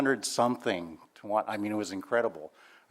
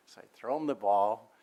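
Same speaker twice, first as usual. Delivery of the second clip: ordinary delivery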